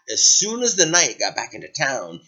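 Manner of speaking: ordinary delivery, nothing marked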